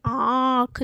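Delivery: आँख with long nasal आँ, separate ख; nasalised vowel demo. Shown as a demonstration. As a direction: stretch आँख through mouth and nose. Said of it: "आप भी हमारे साथ बोलिए".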